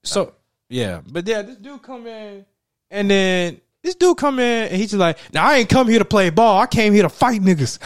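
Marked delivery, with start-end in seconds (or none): 5.3-7.8 loud, much higher tough-guy declaration; menacing threat. Retold or imitated. Outsider arriving at the court, loudly announcing he came to fight.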